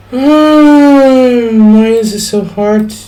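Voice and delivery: high, strained exasperated whine. Real, not acted. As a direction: plead with the computer in frustration. Exasperated at failed message.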